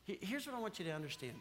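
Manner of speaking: ordinary talk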